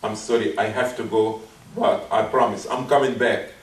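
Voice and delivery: low, softened apology; bow out gently and respectfully; nice, polite way. Acted. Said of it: "but in a nice way".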